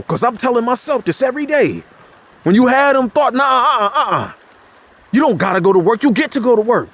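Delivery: impassioned preacher pushing up high; fired-up sermon voice; preaching. Acted. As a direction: challenge the congregation, rising to a peak. Preaching rejection, rising.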